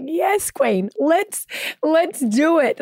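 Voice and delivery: mock hype, high and drawn out; imitated cheering fans. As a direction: lightly act out an encouraging fan. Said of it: "a lot of people would be like".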